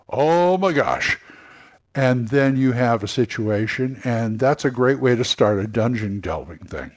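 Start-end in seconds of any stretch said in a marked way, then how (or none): 0.1-1.2 slow, high, stunned; dawning horror. Acted. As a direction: let the realization sink in slowly. Realizing the ogre is real.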